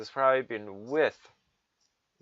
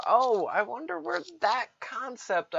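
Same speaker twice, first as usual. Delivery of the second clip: sarcastic wonder; 11 semitones higher than usual. Acted.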